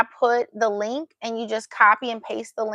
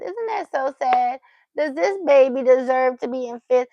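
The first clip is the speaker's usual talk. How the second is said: ordinary talk, nothing marked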